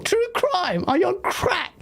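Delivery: high-pitched, incredulous outburst; laughing disbelief. Real, not acted. Host dismissing True Crime as a GTA rival, incredulous. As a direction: scoff in amazement, breaking into laughter. Scoffs at a viewer's suggestion.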